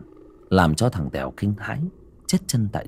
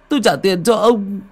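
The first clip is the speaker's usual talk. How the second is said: higher, louder begging; stammer-free repeated plea; 10 semitones higher, 4 dB louder than usual. Acted. Tèo again offers the ghost money, pleading. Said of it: "run rẩy sợ hãi, van xin".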